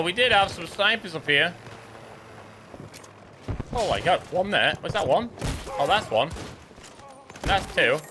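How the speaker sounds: ordinary delivery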